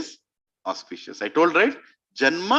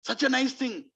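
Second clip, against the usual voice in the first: softly delighted, pitched up; very happy; 5 semitones higher, 7 dB quieter than usual. Acted. Kaikeyi reacts to Ram's coronation, very happy. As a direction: marvel happily at the news, lightly. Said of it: "she was very happy".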